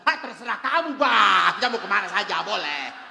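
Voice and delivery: loud laughing Batak bluster; loud blunt answer. Retold or imitated. Suami Batak menjawab keras. (suami Batak menjawab dengan keras sambil tertawa)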